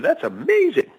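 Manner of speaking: high, amazed exclamation; passerby in the joke, high and amazed; amazed stranger. Acted. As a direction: gush it like the stunned passerby. Stranger reacts in told joke.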